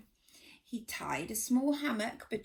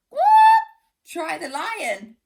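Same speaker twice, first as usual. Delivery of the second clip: squawking bird; 8 semitones higher, 12 dB louder than usual. Acted. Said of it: "squawked another".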